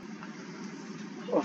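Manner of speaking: quiet, breath-only chuckle; soft laugh. Real, not acted. Teacher still amused by his remark, soft chuckle. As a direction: let out a soft voiceless laugh.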